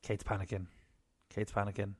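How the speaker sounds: hushed. Real, not acted. Child waking nearby.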